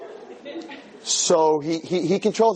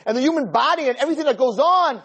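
preaching. Acted. Rabbi preaching to the man that God is not on trial. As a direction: preach it out with full force.

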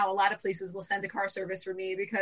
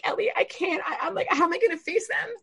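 high-pitched, overwhelmed plea; retold panic, pleading with brother; distraught; 8 semitones higher than usual. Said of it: "I started crying in the car".

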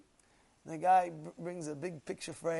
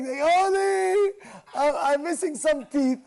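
loud, high, drawn-out mock lament; wailing fox; 11 semitones higher, 13 dB louder, slower than usual. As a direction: bawl slowly like a distraught fox. Acts out fox lamenting its losses.

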